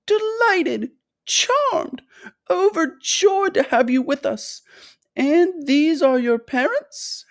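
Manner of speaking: very high, fluty, gushing welcome; effusive high-pitched host; Mister Wonka, excited. A narrator's reading. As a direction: gush with delight, stretching the words. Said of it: "Mister Wonka was clearly just as excited".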